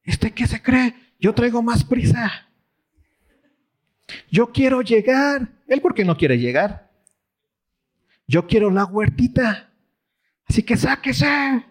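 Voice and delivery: high, indignant entitled grumbling; impatient driver's thoughts. Retold or imitated. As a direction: fume like you own the road. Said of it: "¿qué comienzas a pensar tú?".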